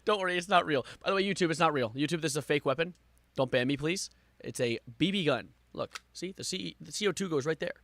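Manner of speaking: ordinary talk, nothing marked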